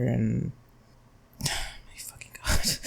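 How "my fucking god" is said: breathy, exasperated sighing; real weary exasperation. Real, not acted. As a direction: sigh it out, fed up and half-voiceless. Exasperated with retelling the movie.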